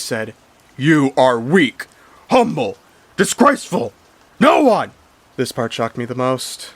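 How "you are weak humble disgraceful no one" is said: slow, loud monster voice rising higher; loud, rising, menacing boom. Retold or imitated. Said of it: "a loud booming voice".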